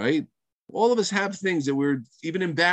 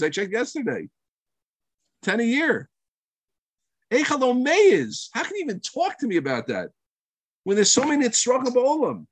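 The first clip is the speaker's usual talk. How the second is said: indignant anger; 9 semitones higher than usual. Acted. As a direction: scold him for wanting a Tesla. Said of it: "So what's our first reaction?".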